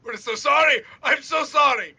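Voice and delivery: high, loud repeated pleading; panicked apology. Acted. Crossbowmen to their bleeding victim, panicked high shouted apology.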